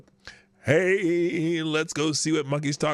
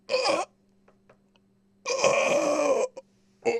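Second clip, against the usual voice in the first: mocking McConnell freeze; 10 semitones higher, much slower than usual. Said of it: "I did a little McConnelling".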